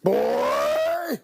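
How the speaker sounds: mock yell.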